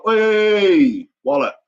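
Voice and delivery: loud, high call to catch someone's attention. Retold or imitated. Alerting a passenger who left a wallet, calling out. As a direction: shout out a drawn, high hey. Calls out to a stranger.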